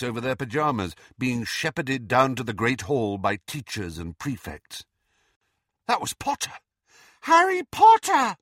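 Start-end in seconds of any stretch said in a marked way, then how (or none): 5.9-8.4 high squeal of recognition; excited cry. A narrator's reading. Student spots Harry passing in the crowd, excited. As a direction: cry out, building to a loud high pitch.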